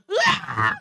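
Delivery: high, loud mock wail; imitated scream. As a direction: scream like girls bursting into tears. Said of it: "소리 지르더니". (여자애들 울음 섞인 비명 흉내)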